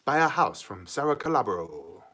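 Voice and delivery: lightly voiced slogan, low and soft; a hint of real estate ad voice; advertising slogan. Streamer pitches a viewer's name as branding, lightly voiced slogan. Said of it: "the branding is too easy".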